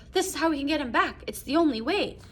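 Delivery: desperate determination. Retold or imitated. Wife begging to wish her son back, lightly acted desperate urgency. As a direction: push it through, lightly acting a desperate mother. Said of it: "So she's mad with determination".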